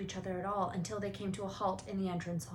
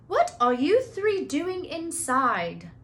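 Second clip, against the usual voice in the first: loud, slow, higher demand across a hall; ringing call; 8 semitones higher, 10 dB louder, slower than usual. Acted. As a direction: stop them with a ringing voice. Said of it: "a voice suddenly rang across the hall".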